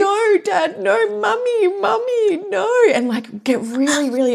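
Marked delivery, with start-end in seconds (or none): 0.0-2.7 put-on high upset little-girl whine; toddler voice. Mother imitating her toddler daughter upset about dad driving, high whiny voice. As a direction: mimic a toddler protesting, pitched way up. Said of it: "She'll go".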